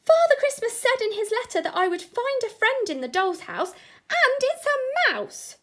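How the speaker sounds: put-on high, loud, excited squeak of a child; squeaking little boy. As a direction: squeak it out in pure delight. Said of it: "squeaked the little boy".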